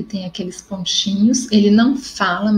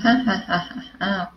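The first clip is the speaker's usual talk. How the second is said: acted laughter; 5 dB quieter than usual. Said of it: "eles rindo".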